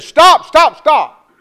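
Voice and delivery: high, loud cry of stop; frantic stop. Retold or imitated. Mother stopping the pastor's prayer, loud cry. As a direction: cut the prayer off sharply.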